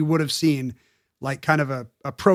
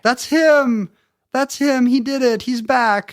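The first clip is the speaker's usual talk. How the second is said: mock mother's cry; 7 semitones higher, slower than usual. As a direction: pitch it up in mock excitement. Mother voiced confirming.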